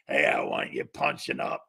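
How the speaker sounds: low whispered dad voice. Retold or imitated. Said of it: "your old man sends me a message".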